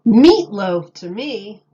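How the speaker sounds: a booming punchline; loud reveal. A narrator's reading. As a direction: shout the answer triumphantly.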